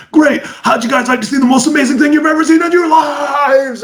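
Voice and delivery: high, shouted showman pitch; over-the-top announcer build-up; brash American hype. Acted. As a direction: play an American magician firing up the crowd. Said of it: "the American approach is".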